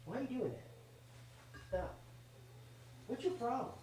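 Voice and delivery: high, hushed pet voice. Real, not acted. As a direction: gently scold the cat in a high coo. Scolding the cat softly.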